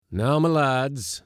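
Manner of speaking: loud, drawn-out, high hail; rally the men with a slow loud call; calling out orders. A narrator's reading. Skipper addressing the lads, calling out loudly.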